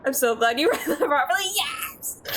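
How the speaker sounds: fast words broken by laughter; laughing. Real, not acted. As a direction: laugh while talking. Laughing at chat.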